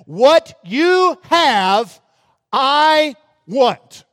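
slow, loud greedy demand; a preacher voicing a looter's greedy mindset. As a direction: spell out the thief's demand, loud and drawn out. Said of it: "they are armed with this mindset".

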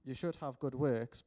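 ordinary talk

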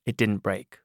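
plain reading voice

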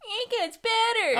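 very high, loud, drawn-out squeal; exaggerated baby voice; mock whiny voice. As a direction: squeal it back teasingly like the owlet. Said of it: "said that in a charming voice".

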